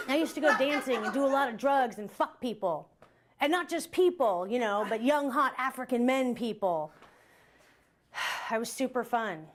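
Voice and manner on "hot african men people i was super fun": usual speaking voice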